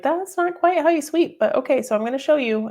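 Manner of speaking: a high, sing-song mock scold about sweeping; mock high teasing voice. Mock correcting son's sweeping.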